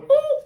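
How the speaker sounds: high excited ooh; a squealed ooh of delight; a high, bright ooh. Real, not acted. Reacting to the reading.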